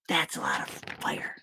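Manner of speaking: soft unvoiced whisper; whispered aside. Real, not acted. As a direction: whisper it like a secret. Aside to listeners during story.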